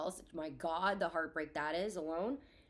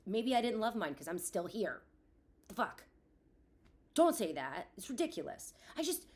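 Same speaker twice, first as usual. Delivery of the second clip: usual speaking voice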